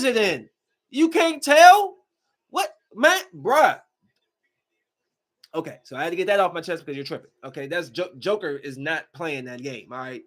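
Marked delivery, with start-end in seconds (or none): none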